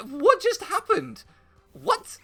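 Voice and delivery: very high, incredulous squeak; stunned disbelief. Retold or imitated. Diner who just saw a pigeon punched, high-pitched disbelief. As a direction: ask it high, in mock bafflement.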